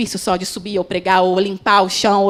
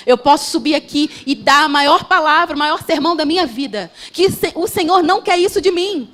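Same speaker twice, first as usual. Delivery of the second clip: higher, loud, urgent sermon voice; fervent preaching; 5 semitones higher, 5 dB louder than usual. Acted. Preacher urging the church that God wants the heart, fervent and loud. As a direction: press the point home to the church.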